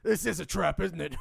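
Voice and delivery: just-woken confused yell; groggy goblin jolted awake, loud; startled Gizrot. Acted. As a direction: wake up panicked and suspicious. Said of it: "Gizrot wakes up".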